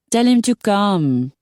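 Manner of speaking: put-on high, slow French-accented pleading; high French-accented coaxing. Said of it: "the French accent continued".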